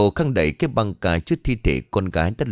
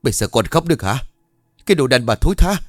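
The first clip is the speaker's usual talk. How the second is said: rising into a loud, high insult; enraged husband cursing; angry abuse; 4 semitones higher, 5 dB louder, faster than usual. A narrator's reading. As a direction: turn on your wife in fury. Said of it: "những lời mắng chửi xì vả này".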